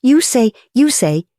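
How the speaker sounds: high, halting repetition; stammering surprise. A narrator's reading. Proprietor turns on the duke, stammering in shock. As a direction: quake with astonishment. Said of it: "he stammered".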